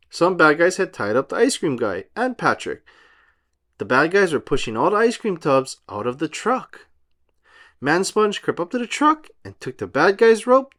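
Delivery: ordinary delivery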